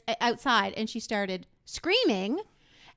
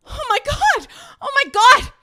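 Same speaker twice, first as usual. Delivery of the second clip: panicked prank yell; 12 dB louder than usual. Retold or imitated. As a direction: yell in mock alarm, high and loud. Mock panic yelling to husband in prank.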